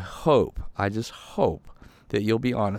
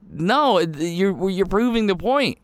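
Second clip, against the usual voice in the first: ordinary talk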